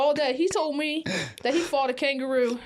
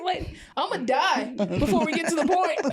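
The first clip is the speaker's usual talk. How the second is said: laughing, higher-pitched exasperation; comic exasperated outburst through laughter; mock impatience; 5 semitones higher than usual. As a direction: cut in as if you cannot wait any longer. Impatient caller cuts in, joking.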